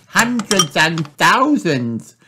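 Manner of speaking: put-on high, slow exclaiming girl; Peppa crying out. A narrator's reading. Sister amazed by spilled batteries, high slow cry. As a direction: marvel at the pile. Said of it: "cries Peppa".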